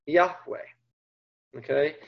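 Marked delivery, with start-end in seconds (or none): 0.1-0.7 "Yahweh" said softly as YAH-weh; name spoken with reverence; Hebrew pronunciation. Shown as a demonstration. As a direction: give "Yahweh" a quiet, careful Hebrew sound. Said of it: "the form you know of as Yahweh".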